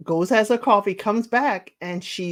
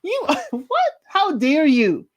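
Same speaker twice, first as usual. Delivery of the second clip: high, slow, offended how dare you; mock-appalled retelling; indignant outrage; 9 semitones higher, slower than usual. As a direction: clutch your pearls and demand it. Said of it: "That's exactly what she said".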